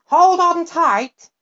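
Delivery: high, loud, slow call; creature voice. Acted. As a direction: shout it over the wind. Creature shouts in flight.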